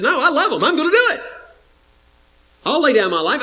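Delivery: eager resolve. Acted. Jesus voiced declaring resolve.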